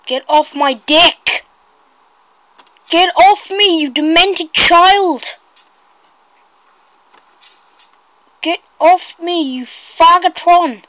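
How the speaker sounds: shouting at him. Real, not acted. Yelling at attacker in game.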